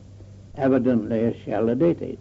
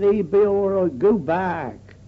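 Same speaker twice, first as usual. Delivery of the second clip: retold shout back; 7 semitones higher than usual. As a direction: call him off from afar, half-shouted. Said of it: "and he shouted back".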